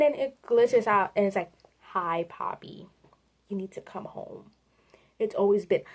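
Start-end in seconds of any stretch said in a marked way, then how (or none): 1.9-4.5 hushed, lower creepy voice; soft and low, luring her in. Acted. Experiment glitching out to lure Poppy home, creepy. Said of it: "it's really creepy".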